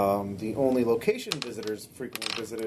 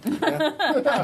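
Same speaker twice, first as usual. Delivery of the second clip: laughing. Real, not acted. GM laughs at roll.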